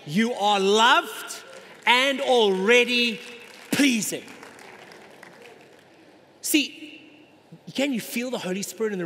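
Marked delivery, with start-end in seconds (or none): 0.1-4.3 loud and slow, every word weighed; booming voice of God. Acted. Pastor voicing God blessing his Son, loud and slow. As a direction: proclaim it like God speaking from heaven.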